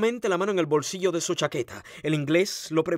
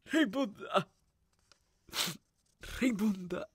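high, soft, broken lament; small high anguished voice; grieving call; 6 semitones higher, 8 dB quieter, slower than usual. A narrator's reading. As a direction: call her name faintly in grief. Grieving over the dead woman.

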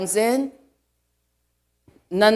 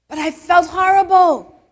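louder, higher outburst; emphatic cry; 9 semitones higher, 8 dB louder than usual. Real, not acted. Preacher confessing to congregation, emphatic shout. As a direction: cry it out to the church.

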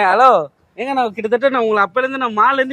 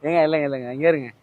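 much lower, settled voice; calming reveal; 8 semitones lower, 3 dB quieter than usual. Real, not acted. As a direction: drop the act and calm them down. Drops act, calms her, reveals prank.

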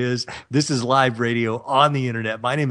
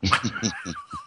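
silly chuckle; 8 dB quieter than usual. Real, not acted. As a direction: chuckle quietly at your own joke. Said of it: "I feel a little silly tonight".

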